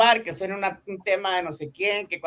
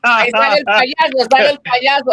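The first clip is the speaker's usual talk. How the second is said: loud announcement; 4 semitones higher, 10 dB louder than usual. Pretend. Announcing the clown with music.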